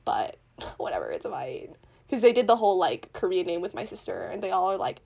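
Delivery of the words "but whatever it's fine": very low, near-whispered shrug; resigned aside. Real, not acted. Speaker brushing off the legal-name regret, resigned murmur. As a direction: drop it to a murmur, letting it go.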